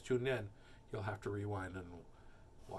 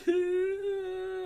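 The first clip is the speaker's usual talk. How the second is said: high, loud drawn-out cry; child's mock wail; 6 dB louder than usual. A narrator's reading. Child wails at father.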